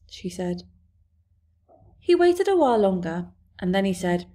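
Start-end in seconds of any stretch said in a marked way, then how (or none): none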